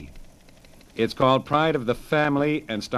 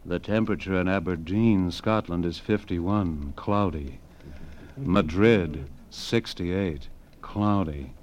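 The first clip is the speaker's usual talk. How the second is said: sexy woman voice; 5 semitones lower, slower than usual. Retold or imitated. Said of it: "a very sexy woman's voice said".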